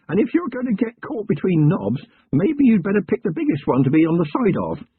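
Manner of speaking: keen country lad proud of his notebook; eager young constable. Acted. Young constable telling his commander, earnest lad voice.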